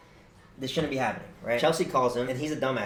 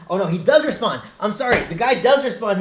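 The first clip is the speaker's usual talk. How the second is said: excited correction; 8 semitones higher, 9 dB louder than usual. Real, not acted.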